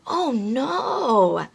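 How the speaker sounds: put-on theatrical oh no for a toddler; mock dismayed oh no. Shown as a demonstration. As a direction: act a fake little wail. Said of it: "A great word through the whole story".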